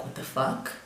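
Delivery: breathy hushed whisper to herself; stunned whisper at the doll; inner whisper. Retold or imitated. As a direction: whisper it like a thought.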